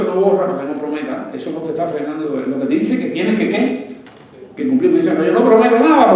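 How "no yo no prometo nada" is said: loud, high mock of a believer dodging promises; lightly voiced churchgoer refusing to promise. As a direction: sneer at someone who won't commit. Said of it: "yo he visto a gente cristiana así".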